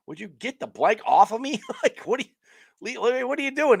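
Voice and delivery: hammed-up gripe at the teammate; mock angry player. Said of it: "he's got, like".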